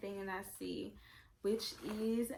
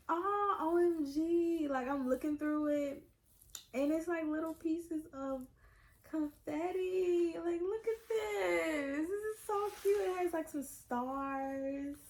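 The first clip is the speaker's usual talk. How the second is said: higher, near-tearful squeal over the confetti; gushing; 6 semitones higher than usual. Real, not acted. Unboxing fan cooing over the confetti, high and near tears. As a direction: coo over every tiny piece.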